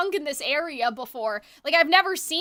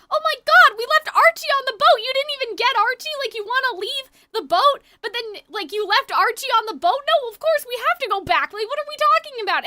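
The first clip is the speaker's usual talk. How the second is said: high, loud alarmed cry; frantic disbelief, voice way up; panicked outrage; 8 semitones higher, 4 dB louder than usual. Retold or imitated. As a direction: panic that the dog was left behind. Panicking about dog left behind.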